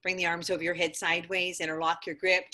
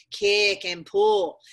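drawn-out coaching call; 6 dB louder, much slower than usual. Real, not acted. Yoga teacher driving a pose.

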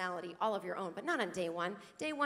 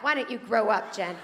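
louder scolding; 7 dB louder than usual. Retold or imitated. Scolding friend in retold argument.

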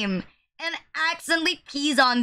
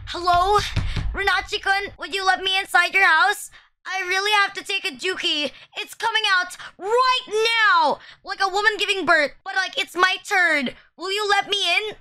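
higher-pitched, put-on pleading voice; pretend pleading visitor; 4 semitones higher than usual. Streamer acting a visitor needing the toilet, higher-pitched pleading. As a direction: play a desperate stranger begging at the door, pitched up. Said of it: "What if I do this? Like".

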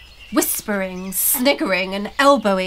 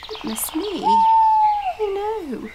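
alarmed; 11 semitones higher than usual. A narrator's reading. Blossom seeing trolls build fires, alarmed.

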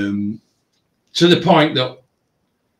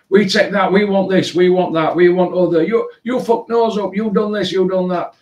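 much higher mimicry of abuse; mocking the couple; 8 semitones higher than usual. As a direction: squawk it like a ranting customer. Couple voiced shouting demands.

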